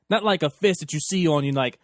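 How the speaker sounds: ordinary delivery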